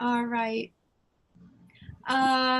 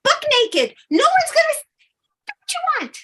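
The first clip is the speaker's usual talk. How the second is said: higher, louder, giddy fairy cheer; cheering fairy guides; 7 semitones higher, 7 dB louder, faster than usual. Acted. Fairy guides urging Leah to take action, loud and giddy. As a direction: hype her up like excited fairy cheerleaders.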